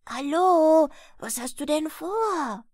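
sudden loud call of Hallo; startling shout; shouting in his ear. A narrator's reading. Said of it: "bis dieser ihm ins Ohr schrie".

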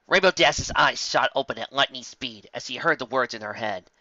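ordinary delivery, nothing marked

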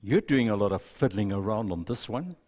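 soft, hushed storytelling read; story narration. Acted. Pastor reading a story to the congregation, softly told. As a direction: read the tale gently to the congregation.

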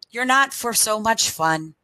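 plain reading voice

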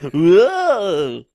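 loud, high mock scared cry; hammed-up frightened yelp; spooked and shaky. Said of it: "That's my impression of a spooked person".

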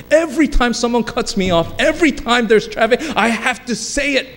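high-pitched mock exasperation; exasperated complainer. Voicing frustrated drivers who curse.

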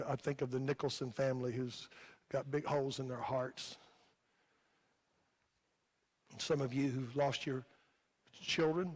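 real grief, quiet and low, with long pauses; a little emotional. Real, not acted. Preacher missing his dad and naming others' losses, emotional. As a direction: speak softly, halting over the loss. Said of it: "I come a little bit emotional today".

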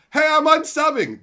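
high, loud mocking whine; mocking viewer. Streamer mocking a viewer who unsubs, high loud whine. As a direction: mimic the quitter with a loud sneer. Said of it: "and then go".

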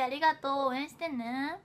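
usual speaking voice

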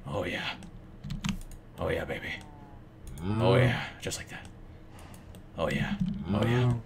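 soft, low teasing oh yeah; hammed-up seductive murmur; mock sultry. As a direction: purr it like a cheesy flirt. Suggestive milking joke.

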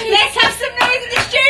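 loud, high hype man shout through a laugh. Retold or imitated. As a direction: fire up the studio crowd. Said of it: "there's a hype man".

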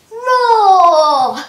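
loud lion roar. Shown as a demonstration. Teacher counting the push-ups with a loud lion roar. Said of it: "and then you go roar".

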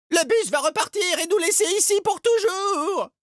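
very high, loud cry of alarm; panicked shout. A narrator's reading. The magician warns the bus will leave, shouting in panic. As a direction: cry the warning out in desperation. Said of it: "s'écria le magicien".

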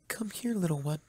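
soft, high, gentle call. Acted. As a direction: call it over tenderly. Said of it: "a soft voice called out".

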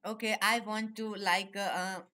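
usual speaking voice

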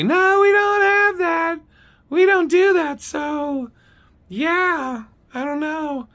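loud, high mocking interruption; high caricature, trailing into vagueness; Dee's high-pitched voice. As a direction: cut in as a squeaky dismissive clerk. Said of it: "she fucking interrupts me".

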